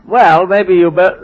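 ordinary delivery

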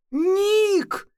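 shrill shout of the name; scream. Acted. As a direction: scream his name in horror. Narrator screams at the killer.

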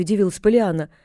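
ordinary delivery, nothing marked

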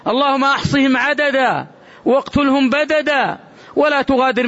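high, impassioned sermon voice, pressing each plea; fiery preaching. Acted. Preacher urging his congregation to call only on God, fiery and impassioned. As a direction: thunder at the congregation, then cry out the supplications.

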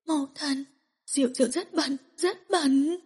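lost, weeping plea; tearful. Acted. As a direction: murmur it through tears. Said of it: "giọt nước mắt".

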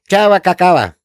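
put-on a small girl echoing the schoolgirl's goodbye; little child chirping. A narrator's reading. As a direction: parrot the farewell like a toddler.

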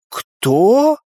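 high, rising one-word question; puzzled query sliding upward; bewildered. A narrator's reading. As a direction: ask in baffled surprise, pitch climbing. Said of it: "с недоумением спросила подруга".

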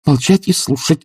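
high, sharp command; angry master's yell; shouted order. A narrator's reading. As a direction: cut her off with a cry. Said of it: "крикнул Обломов так".

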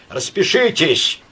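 high, loud, slow snarl of a demand; spiteful order. A narrator's reading. Stranger at the door orders Myshlaevsky, spiteful and loud. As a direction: spit the order through the door. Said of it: "злобно сказал голос за дверью".

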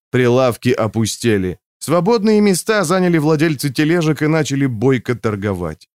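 ordinary delivery

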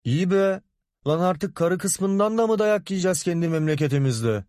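high, held-up whining outrage; indignant protest. A narrator's reading. Man protests in a quarrel.

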